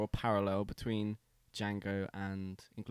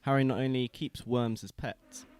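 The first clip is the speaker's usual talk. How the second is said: normal talking voice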